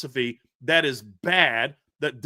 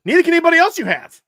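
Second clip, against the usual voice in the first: much higher, louder and fast; ranting yell at the coaches; exasperated shout; 11 semitones higher, 9 dB louder, faster than usual. Real, not acted. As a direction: blurt it out in a furious burst.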